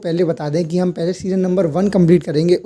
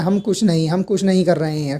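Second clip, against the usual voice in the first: usual speaking voice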